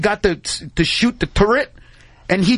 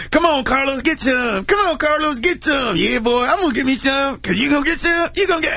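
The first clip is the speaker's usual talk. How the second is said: higher, sing-song hillbilly taunt; 7 semitones higher than usual. Retold or imitated. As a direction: egg him on like a goofy country boy. Goofy redneck kid quoted.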